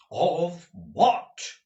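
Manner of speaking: eager demand, voice leaping up; crying out. A narrator's reading. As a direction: cry it out as it suddenly dawns on you. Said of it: "cried Ralph".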